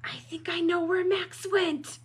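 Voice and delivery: high-pitched dawning alarm; worried storybook voice; alarmed realization. Acted. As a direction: say it as the worry hits. Alarmed realization after the gasp.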